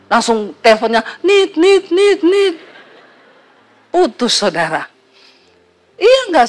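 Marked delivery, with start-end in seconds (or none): 1.2-2.6 high mimicked phone beeps, laughing. Pastor mimics the phone cutting off after the husband hangs up, high beeps. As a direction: imitate the dead line tone.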